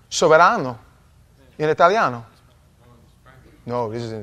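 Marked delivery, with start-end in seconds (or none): 0.1-2.3 loud, playful Italian accent. Shown as a demonstration. Preacher showing the church some Italian, exaggerated Italian accent. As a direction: punch it out like an Italian. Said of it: "say it with a little Italian accent".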